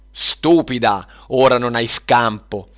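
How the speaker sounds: loud, contemptuous witch's threat; shouted threat. Acted. Witch of discord corners Luna, shouting. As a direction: raise your voice without raising pitch. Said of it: "urlò la strega della discordia".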